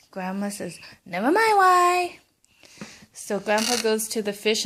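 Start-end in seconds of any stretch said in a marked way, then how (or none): 1.0-2.2 high, loud mean wife cutting him off; snapping wife. Acted. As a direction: snap it at the old man. Wife snaps at grandpa.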